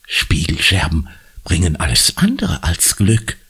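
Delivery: usual speaking voice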